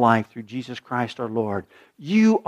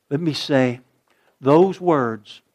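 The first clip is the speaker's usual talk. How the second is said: ordinary talk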